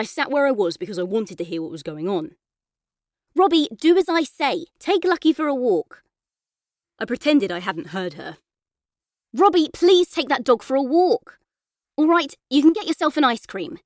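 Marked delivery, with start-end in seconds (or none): 3.3-13.8 Mum insisting. A narrator's reading. Mum insisting son walks dog.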